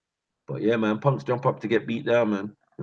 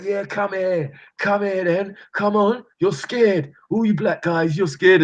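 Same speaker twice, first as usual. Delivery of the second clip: mocking bus driver; 11 semitones higher, slower than usual. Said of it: "He's like".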